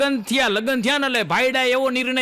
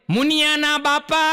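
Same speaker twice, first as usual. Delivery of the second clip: higher mock wife calling out; imitated wife's call; 6 semitones higher than usual. Wife calling her husband years into the marriage, mock call. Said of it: "પાછો tone ફરે".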